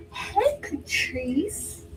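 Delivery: high, stretched-out slow hello; happy phone greeting. Retold or imitated. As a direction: drag out a delighted hello. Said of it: "That's Marilyn".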